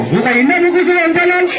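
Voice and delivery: indignant teacher. Acted. Teacher indignant at absurd answer.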